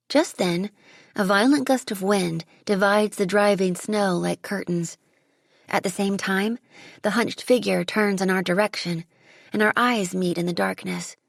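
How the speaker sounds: plain reading voice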